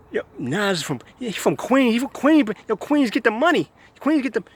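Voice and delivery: high-pitched mock Queens hype voice; mocking Queens guy. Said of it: "some Queens idiot will say".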